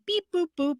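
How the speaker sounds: high beeping robot noises. Retold or imitated. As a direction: beep like a little toy robot. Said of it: "he was making little robot noises".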